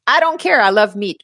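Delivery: high, breezy shrug of a voice; carefree meat lover. Pretend. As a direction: toss it off like you don't care at all. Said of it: "Are you like".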